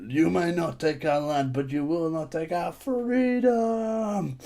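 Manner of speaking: drawn-out speech rising high on the last word; slow rallying cry, hammed up without real volume; mock battle speech. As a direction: stretch it out like a mock heroic cry. Film war cry quoted mockingly.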